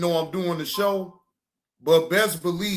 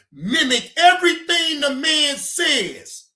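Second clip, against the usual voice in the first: heated; 8 semitones higher, 5 dB louder than usual. Real, not acted. Ranting about a mimic.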